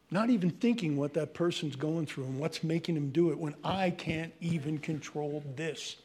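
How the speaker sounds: ordinary talk, nothing marked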